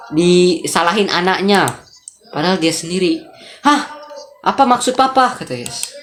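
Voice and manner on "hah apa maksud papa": put-on indignant child. Kayla questions her papa's blame, indignant. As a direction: question him like an offended child. Child challenges father in game dialogue.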